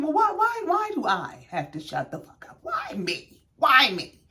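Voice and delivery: mock dramatic whine. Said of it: "Quad first said".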